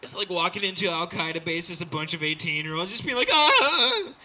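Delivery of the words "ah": high, loud yell; hammed-up teenage soldier's cry; mock battle scream. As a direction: scream like a raw recruit charging in.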